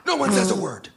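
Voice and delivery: very high, tense producer voice; frantic high-pitched warning, mock-serious; Gelman. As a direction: imitate a panicked order to everyone backstage. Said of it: "Gelman, who's the producer of the show".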